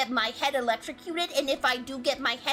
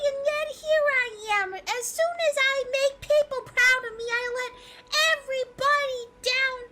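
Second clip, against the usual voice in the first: mock crying; 8 semitones higher than usual. Continues mock crying rant.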